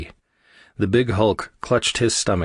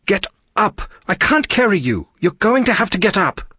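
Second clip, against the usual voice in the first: high, loud exasperated yell; raised, insistent shouting; 13 semitones higher, 5 dB louder than usual. A narrator's reading. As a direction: yell at someone to move. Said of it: "resorted to yelling".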